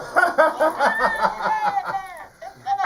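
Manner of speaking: high, loud string of ha-has; long acted cackle of a boy who fooled everyone; prankster's big staccato laugh; hearty mocking laugh. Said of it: "had a good laugh".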